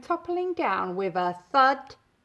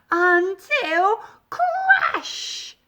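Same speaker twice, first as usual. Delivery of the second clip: dramatic crash; 7 semitones higher, 6 dB louder, much slower than usual. A narrator's reading. Dramatic narration climax.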